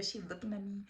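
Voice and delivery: normal talking voice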